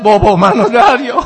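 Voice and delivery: high, loud wail rising into the son's plea; imitated dying son's loud call; anguished cry. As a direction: cry out for father as a lamenting eulogist.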